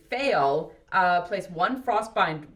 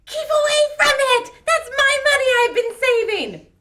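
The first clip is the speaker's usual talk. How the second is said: very high, loud crazed screech; screaming; 11 dB louder, faster than usual. A narrator's reading. Filthy woman with knife warning off stranger, screaming. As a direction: scream it full out, guarding your hoard. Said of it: "lifts up the knife and screams".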